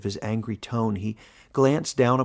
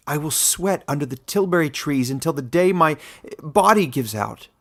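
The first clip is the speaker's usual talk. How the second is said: raised, rising despair in his voice; a young outcast pouring out his despair, louder; 4 semitones higher, 6 dB louder than usual. A narrator's reading. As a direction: plead his hopeless lot, voice swelling. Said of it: "The despair in his voice".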